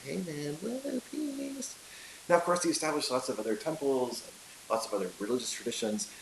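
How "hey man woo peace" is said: mellow hippie with a high woo; a hint of a chill hippie, peace-out; laid-back hippie Numa. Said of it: "Numa's like".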